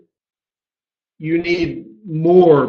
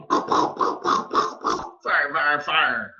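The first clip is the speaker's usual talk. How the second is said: gunslinger fire call; 8 dB quieter, much slower than usual. Pretend. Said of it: "Now you're Billy the Kid".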